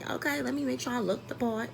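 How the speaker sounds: ordinary delivery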